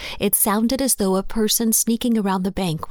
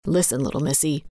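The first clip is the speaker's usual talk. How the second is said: low, stern warning; stern, lowered voice; 4 semitones lower, faster than usual. A narrator's reading. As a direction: sternly put her in her place, keeping the voice down. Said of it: "he said sternly, but still kept his voice lowered".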